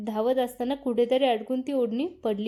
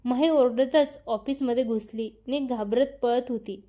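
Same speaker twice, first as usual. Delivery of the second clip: usual speaking voice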